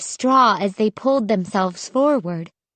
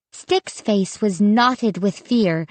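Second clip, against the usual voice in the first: usual speaking voice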